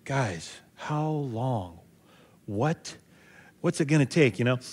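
lightly voiced Jesus, quietly fed up; weary exasperation. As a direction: sigh it out like a tired teacher with his class.

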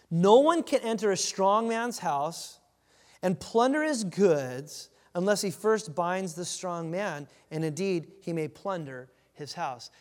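slow, loud, weighty proclamation; solemn scripture reading. Acted. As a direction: read every word like a verdict. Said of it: "let me read it again".

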